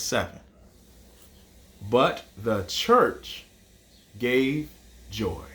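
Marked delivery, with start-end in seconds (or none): 1.9-5.4 slow, fading haiku line; slow recitation. Shown as a demonstration. Teacher reciting the final haiku line slowly. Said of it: "our first line was five".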